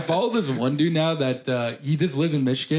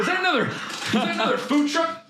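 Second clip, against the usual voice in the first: mock surprise; 11 semitones higher than usual. Mock reaction to rival truck.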